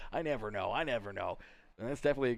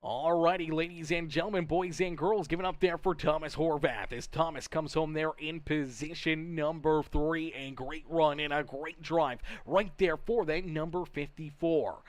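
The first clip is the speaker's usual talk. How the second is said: high-energy race announcer, higher pitched; big ring-announcer send-off for a driver; 3 semitones higher, 6 dB louder than usual. Acted. Broadcaster calls for applause for Thomas Horvath, announcer voice.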